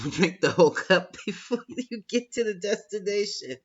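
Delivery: amused mocking jab at the Knight of Cups; laughing tease. Real, not acted. Teasing about Knight of Cups.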